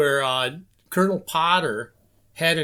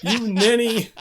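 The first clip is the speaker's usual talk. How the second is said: higher-pitched mock scolding; retold yell; 6 semitones higher than usual. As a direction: imitate an indignant insult. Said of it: "he yelled at Igor".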